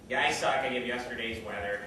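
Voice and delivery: usual speaking voice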